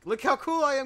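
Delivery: high, loud, smug self-mockery; mock cocky boast. Mocking his own show-off slide before he died. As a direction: brag like a show-off.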